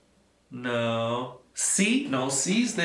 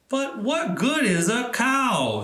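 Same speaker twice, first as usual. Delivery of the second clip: put-on puzzled old man; 8 semitones higher than usual. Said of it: "said Peter".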